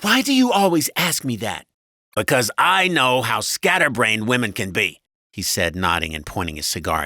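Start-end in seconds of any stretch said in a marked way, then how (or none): 0.0-1.7 high, loud exasperated wife; loud, high wifely irritation; annoyed wife. A narrator's reading. 2.2-5.0 loud, pitched-up husband; raised, patronizing husband voice; condescending husband. A narrator's reading. Husband lectures wife.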